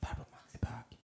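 hushed, voiceless imitated whisper. Dad prompting his child during a video call, whisper. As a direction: whisper it as if prompting someone's ear. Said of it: "Så bara viskar han".

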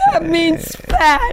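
high, slow mock wail; mock dismay. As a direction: stretch it out in high-pitched dismay. Retold dismay at euphemism.

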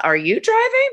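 squeaky high mock-innocent question; sassy comeback. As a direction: fire it back with sweet sarcasm. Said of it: "you should have looked at her and said".

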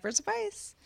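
high, soft mock deadpan; deadpan friend. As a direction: toss it back with a tiny smirk. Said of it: "And she'd be like".

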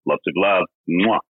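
voicemail greeting. Pretend. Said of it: "Let me start again".

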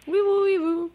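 quiet echoed siren noise; soft siren echo. Retold or imitated. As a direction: gently repeat the siren. Host echoes caller's siren.

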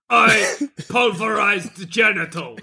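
slow broken-English monster; drawn-out Bigfoot impression. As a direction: report it like a movie monster. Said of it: "And he'll be like".

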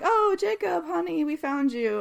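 high, lightly acted coo of a parent; relieved parent. Parents recognize their son Jacob in the woods, mock-relieved. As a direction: imitate a parent finding a lost child.

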